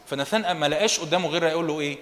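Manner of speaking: normal talking voice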